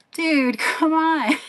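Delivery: exasperated. Retold or imitated. Exasperated at singer's stage antics.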